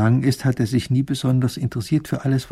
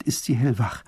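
usual speaking voice

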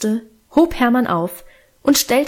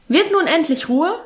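scolding father; 6 semitones higher than usual. A narrator's reading. Strict father disturbed in his room, scolding. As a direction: scold them with raised pitch. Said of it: "schalt Vater in erhöhter Lautstärke".

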